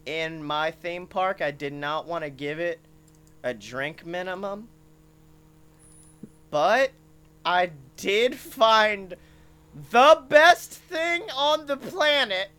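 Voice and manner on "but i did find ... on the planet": slow, high, loud build; slow swelling showman reveal; dramatic reveal. Acted. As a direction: stretch it out for the big reveal.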